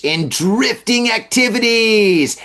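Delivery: DRIFTING ACTIVITIES drawn out slow and high; hammed-up, stretched "drifting activities"; mock-dramatic emphasis. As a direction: read "drifting activities" like a grand absurd term. Mocks the article's formal term.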